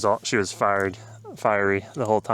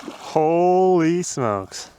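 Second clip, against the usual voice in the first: amazed; 7 semitones higher, much slower than usual. Real, not acted. Seeing the monster pike.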